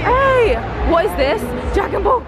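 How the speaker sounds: high, keen call of discovery; excited discovery. Real, not acted. Vlogger spotting the Dragon Ball stand, high excited call. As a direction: point it out, thrilled.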